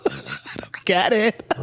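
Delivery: breathy laughing whisper; half-laughed confusion. Real, not acted. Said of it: "whisper, laughs".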